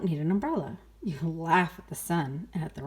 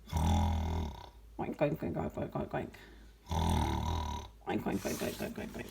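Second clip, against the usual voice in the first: low sleepy pig snores fading out; snoring oinks; 14 semitones lower, 4 dB quieter than usual. Acted. Sleeping mother pig snoring, low oinks. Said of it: "she was sleeping".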